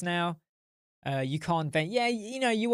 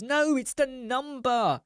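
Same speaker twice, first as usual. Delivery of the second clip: exasperated explaining; 9 semitones higher than usual. Retold or imitated. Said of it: "she'll be like".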